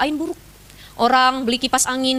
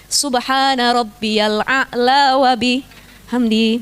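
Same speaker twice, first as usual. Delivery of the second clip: very slow recited prayer; prayer recitation; 2 semitones lower, much slower than usual. Shown as a demonstration. Ustazah shows the women the prayer words, slow recitation. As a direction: intone it slowly like in prayer.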